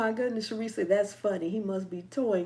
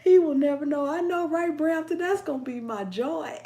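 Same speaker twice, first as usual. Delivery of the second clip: high, loud start, easing as laughter rises; gleeful gloating; 6 semitones higher, 6 dB louder than usual. Real, not acted. Host relishing her secret prank on him, gleeful. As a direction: crow over the secret, half laughing.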